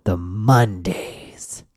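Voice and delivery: sagging, slow self-pity; mock moan. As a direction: sink into the Mondays like a deflated victim. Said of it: "they'd go".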